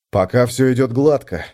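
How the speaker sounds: usual speaking voice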